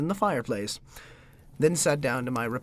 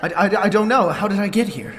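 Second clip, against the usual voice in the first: louder stammering confusion; stammer, still rattled; shaken; 4 semitones higher, 8 dB louder than usual. Acted. Talbot asking Hiddlings how he got here, shaken.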